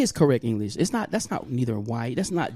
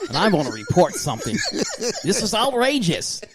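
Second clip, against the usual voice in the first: proper phone-caller voice building to higher indignation; 4 semitones higher than usual. Acted. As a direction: put on a job interview voice, stretching the last complaint.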